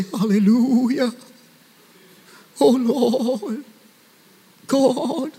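mock pious, drawn-out wavering cry; put-on prayer voice. Preacher mimicking how people come to God, affected prayer voice. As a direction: stretch each word in an affected holy voice. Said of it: "All this voice thing".

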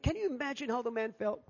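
normal talking voice

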